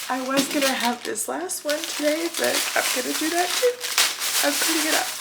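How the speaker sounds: choked-up self-affirmation; tearful resolve. Real, not acted. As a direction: push the words out through tears. Tearful resolve, giving up food.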